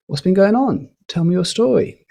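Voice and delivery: "What's been going on? Tell me your story" pitched high, rising to invite an answer. Shown as a demonstration. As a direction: lift the pitch up on "story" as an open question. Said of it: "great for when you wanna get people involved".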